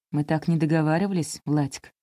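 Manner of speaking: ordinary delivery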